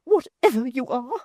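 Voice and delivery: high, loud, stern demand; fierce high sternness; desperate sternness. A narrator's reading. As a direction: order her to confess. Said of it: "with desperate sternness".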